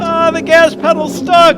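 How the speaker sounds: high, loud panic at the stuck pedal; alarmed yelp as the car runs away; panicked cry. Acted. Car out of control.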